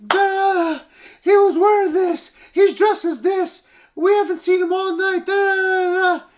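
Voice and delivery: very high, loud mock panicked mom; mom in shrill panic. Mom in the living room panicking over missing Elliot, very high loud squeal. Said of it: "the mom ... saying".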